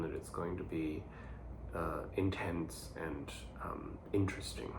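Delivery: quiet, subdued voice from heartburn; speaking through heartburn discomfort. Real, not acted. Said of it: "'cause I have heartburn".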